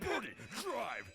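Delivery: high, drawn-out getaway command; urgent order. Acted. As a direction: act a robber ordering the driver off.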